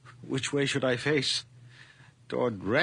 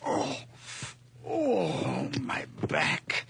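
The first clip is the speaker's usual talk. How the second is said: pained groan; 7 semitones higher, much slower than usual. Acted. Old man groans in pain.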